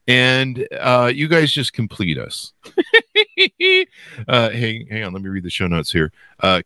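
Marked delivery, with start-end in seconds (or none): 2.8-3.8 high-pitched giggle burst. Real, not acted. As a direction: giggle up high at your own joke. Host laughs at own joke.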